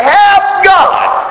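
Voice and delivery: high shouted plea; cry for help. Retold or imitated. Preacher urging the congregation to cry out, shouted plea. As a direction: call out to God from anywhere. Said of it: "you can cry out and say".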